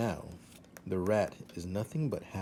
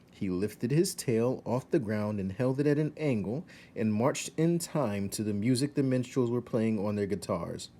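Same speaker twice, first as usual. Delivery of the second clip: usual speaking voice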